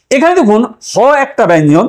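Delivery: ordinary talk, nothing marked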